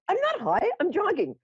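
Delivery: high, fast, flustered denial; retelling her own high-pitched flustered protest to the crowd; indignant protest. Retold or imitated. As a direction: insist in a rush that you're not high. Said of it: "I'm like".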